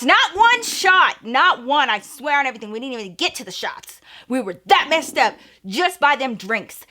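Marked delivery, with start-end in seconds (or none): 0.0-1.2 high and slow, "one" hit hard; insisting, "Not one shot" stressed high; emphatic denial. Real, not acted. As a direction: punch "one" slowly. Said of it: "you would have thought".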